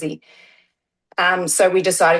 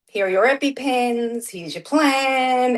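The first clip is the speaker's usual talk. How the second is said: higher, sing-song listing with stretched endings; mimicking clinic handover; 7 semitones higher than usual. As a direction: reel off each item in a raised, drawn-out chant. Clinic briskly handing over instructions, retold.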